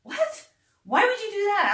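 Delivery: very high incredulous yelp; mock disbelief. Friends shocked she wants another go, high incredulous. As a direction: squeak it in disbelief. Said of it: "And they're like".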